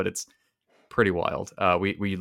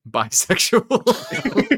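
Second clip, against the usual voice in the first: slow drawn-out word; 13 semitones higher, 7 dB louder, much slower than usual. Pretend. Drawn-out mock answer.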